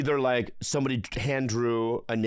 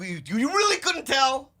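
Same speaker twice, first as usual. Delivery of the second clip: loud, high disbelief; incredulous shout; 11 semitones higher, 4 dB louder than usual. Real, not acted. Disbelief at friend's answer.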